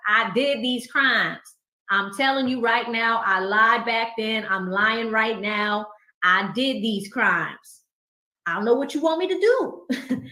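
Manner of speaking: loud, drawn-out Little Woody impersonation. Acted. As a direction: protest it loudly, stretching the words. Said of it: "I just threw a little Woody voice on".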